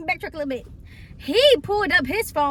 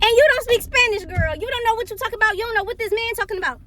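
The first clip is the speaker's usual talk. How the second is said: high, fast indignant rant; acted scolding; 8 semitones higher, 3 dB louder, faster than usual. Passenger telling off drunk friend, high rapid scolding. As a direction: tell her off in a high rush.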